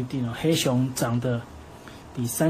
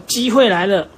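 higher, louder eager shout; eager cry; 5 semitones higher, 6 dB louder than usual. Acted. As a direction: pounce on the opening. Tiger seizes his chance. (兴奋地高喊)

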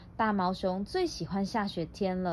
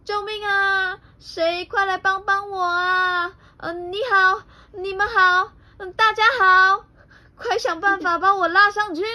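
frightened shouting; 11 semitones higher, 7 dB louder than usual. Acted. Bear trapped in a big hole, frightened shouting. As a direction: wail desperately for rescue, stretching the words. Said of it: "大毛熊害怕的大叫着".

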